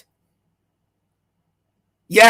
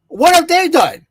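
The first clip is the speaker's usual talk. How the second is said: raised, exasperated repeat; louder, higher repeat; 6 semitones higher than usual. Retold or imitated. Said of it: "I'm like".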